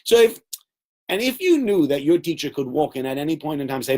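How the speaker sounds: ordinary delivery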